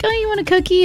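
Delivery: high-pitched put-on offering voice; mock cookie offerer. Imitating people who call a Fig Newton a cookie, high mock voice. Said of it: "some people will be like".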